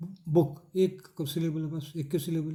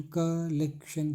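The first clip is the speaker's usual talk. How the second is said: Collection slow, in three beats, KAA-LEK-SHAN; syllable breakdown; 3 dB quieter, much slower than usual. Shown as a demonstration. Teacher counting syllables in collection, slow separated delivery. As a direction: give collection three puffs of air. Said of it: "तीन हवा का झोंका".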